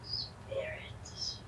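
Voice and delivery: breathy hushed summons; whispering. Acted. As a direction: whisper it to the dark. Medium whispers to summon spirits.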